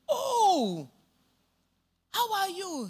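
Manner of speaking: high-pitched, excited exclamation of recognition; retold delighted scream. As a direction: imitate dad greeting a long-lost acquaintance loudly. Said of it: "My dad screamed".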